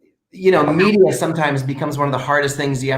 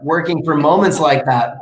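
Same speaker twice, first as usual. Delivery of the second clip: usual speaking voice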